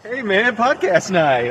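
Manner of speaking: excited. Acted. Excited podcast opening call.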